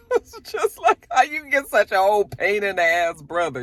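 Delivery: high, laughing through the words. Real, not acted. Recapper laughing about the annoying brother, laughing. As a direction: laugh it out in disbelief.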